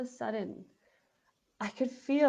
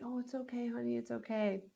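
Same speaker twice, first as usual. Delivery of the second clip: soft, higher, soothing reassurance; soothing husband; 4 semitones higher, 6 dB quieter than usual. Retold or imitated. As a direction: coo it gently to a sick wife. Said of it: "And he's like".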